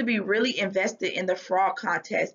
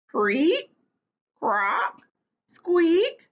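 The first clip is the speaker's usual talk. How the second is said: short frog calls, rising to a high, loud squeak; imitated frog calls; 8 semitones higher, 4 dB louder, much slower than usual. A narrator's reading. Stink tries out frog calls on his friends, imitated croaks and squeaks. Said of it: "he tried some out on his friends".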